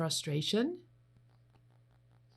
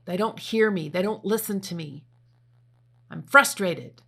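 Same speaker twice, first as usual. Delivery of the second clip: louder, exasperated complaint; mock frustrated; 7 dB louder than usual. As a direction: vent like nobody listens. Acting out frustration.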